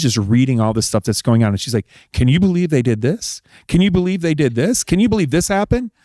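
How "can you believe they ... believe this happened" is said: mock outrage, pitch climbing with each repeat; furious outrage. Husband imitating his furious wife reading the news, rising indignation. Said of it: "like, furious".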